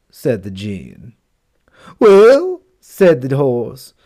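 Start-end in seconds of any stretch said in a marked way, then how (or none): 2.0-2.6 high, loud eager exclamation; eager well. Acted. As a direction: jump in eagerly to tattle. Horse eagerly starts complaint.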